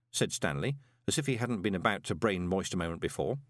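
usual speaking voice

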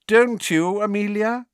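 high, mocking question; smirking. A narrator's reading. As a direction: needle with an audible smirk. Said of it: "still smirking".

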